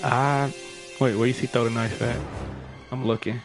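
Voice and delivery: ordinary talk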